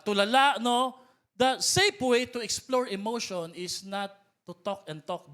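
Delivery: ordinary talk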